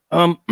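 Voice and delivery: retold nervous throat clearing, twice. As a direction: clear the throat hesitantly before bad news. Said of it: "he literally cleared his throat".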